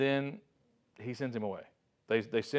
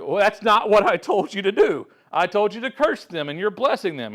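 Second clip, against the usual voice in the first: higher, much louder indignant rebuke; angry complaint; 8 semitones higher, 8 dB louder than usual. Retold or imitated. Balak rebukes Balaam for blessing, loud and higher. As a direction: scold him like a cheated client. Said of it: "each time Balak was like".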